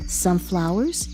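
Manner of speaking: ordinary delivery, nothing marked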